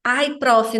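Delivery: ordinary talk, nothing marked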